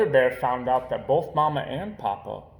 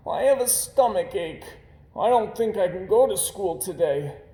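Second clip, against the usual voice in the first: faking sick; 7 semitones higher, 3 dB louder than usual. Acted. Brother faking a stomach ache.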